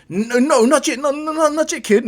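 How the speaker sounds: high loud panicky no no; flustered denial. Acted. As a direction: caught out and scrambling. All Might flustered denial.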